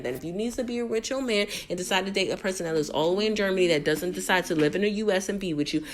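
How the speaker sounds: normal talking voice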